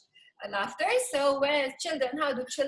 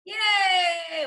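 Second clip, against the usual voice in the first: loud high cheer; cheering yay; 4 semitones higher, 6 dB louder than usual. Shown as a demonstration. Teacher leading the class cheer. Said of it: "Very good, very good. Yay".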